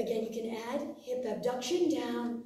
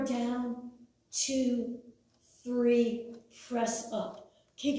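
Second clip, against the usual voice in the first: ordinary talk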